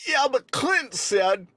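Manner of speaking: high, very slow drawn-out whine; imitating Lat Molly. Praised Lat Molly impression, high slow drawn voice. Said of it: "that's a good Lat Molly".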